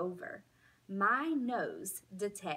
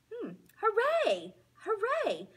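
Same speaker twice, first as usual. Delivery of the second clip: much higher, loud cheering; excited cheer; 13 semitones higher, 5 dB louder than usual. A narrator's reading. Dog spotting the indoor dog park, cheering.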